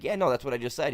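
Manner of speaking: insist, a touch indignant; mock defensive. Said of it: "I was like".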